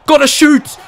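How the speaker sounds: loud, high-pitched real urging; urgent shout. Real, not acted. Gamer willing the player to shoot, urgent shout. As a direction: shout for the shot.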